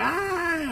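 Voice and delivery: high, sudden mock scream of fright; scared scream. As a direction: yelp like a kid splashed by a cannonball. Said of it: "My people will be all fucking scared".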